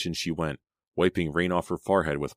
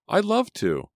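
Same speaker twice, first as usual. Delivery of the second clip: very high, louder, delighted reply; eager acceptance; 5 dB louder, slower than usual. A narrator's reading. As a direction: jump at the invitation, pitch soaring. Selina eagerly accepts a date.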